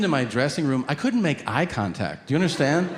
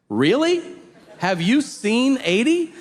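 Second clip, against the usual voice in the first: incredulous; 9 semitones higher, slower than usual. Acted. Mocking living to a hundred.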